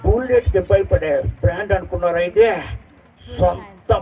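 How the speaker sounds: mimicry of the Pushpa hero's boasting dialogue; Allu Arjun as Pushpa. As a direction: drag each line out with rustic swagger. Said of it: "ఇంకో voice చేస్తున్నాను".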